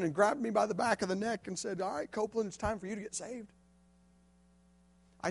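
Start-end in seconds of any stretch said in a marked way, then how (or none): 1.8-3.5 much softer, firm and close; voice of God. Retold or imitated. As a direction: say it close and certain. God grabbing him by the neck.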